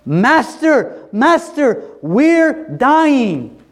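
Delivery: high-pitched, full-voiced yell; panicked yelling. Disciples crying to Jesus as they drown, panicked yelling. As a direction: yell desperately to wake someone. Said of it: "This is them yelling".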